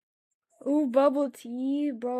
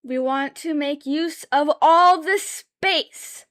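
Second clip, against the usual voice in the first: yelling; 3 semitones higher, 7 dB louder, slower than usual. Real, not acted. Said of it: "Why am I yelling?".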